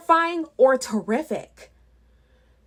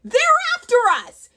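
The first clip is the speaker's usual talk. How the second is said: high, loud, fast goblin yell; panicked goblin shrieking the alarm; 12 semitones higher, 5 dB louder, faster than usual. Acted. Goblin flees toward the ski slopes yelling, high and fast. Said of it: "yelled another goblin".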